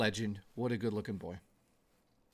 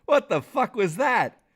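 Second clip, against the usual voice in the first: louder, higher, slowed disbelief; loud shocked what-the; startled outburst; 6 semitones higher, 8 dB louder than usual. Real, not acted. Streamer reacting to a strange sound, startled loud disbelief.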